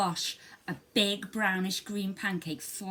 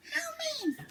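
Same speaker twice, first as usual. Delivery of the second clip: high squeaky mole cry of outrage; a tiny mole yelps in indignation; little mole crying out. Acted. Little mole outraged at the mess, squeaky high cry. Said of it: "cried the little mole".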